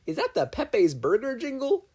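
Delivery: high, loud, slow eager question; thrilled to catch the jingle; excited question. Acted. Mabel asking the others about the jingle, excited.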